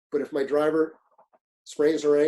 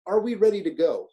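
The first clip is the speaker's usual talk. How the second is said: calling out; 5 semitones higher, 5 dB louder than usual. Retold or imitated. Captain calling the agent.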